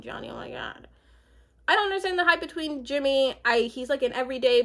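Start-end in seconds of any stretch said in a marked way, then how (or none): 0.0-0.9 embarrassed whispered aside. Real, not acted. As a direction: breathe it out to yourself. Embarrassed about name mix-up.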